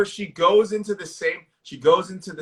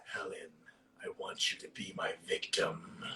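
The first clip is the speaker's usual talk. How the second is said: much lower and softer, intimate menace; deep villain voice; 12 semitones lower, 18 dB quieter than usual. Retold or imitated. Reciting the villain's line to his co-host, deep and menacing. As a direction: breathe it low like a lurking killer. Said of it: "He says".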